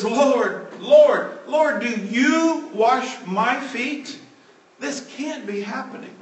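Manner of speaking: pleading in disbelief, voice climbing; shocked protest. Retold or imitated. Peter protesting to Jesus about his feet, high shocked pleading.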